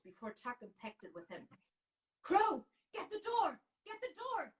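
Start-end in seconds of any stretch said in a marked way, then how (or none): 2.2-4.5 high, loud command across the room; urgent shout. Acted. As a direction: bellow it mid-charge. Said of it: "Tuck shouted".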